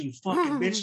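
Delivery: high-pitched re-enacted insult; retold angry snap. Storyteller re-enacting spitting at the girl, mock angry snap. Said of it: "And I snap too".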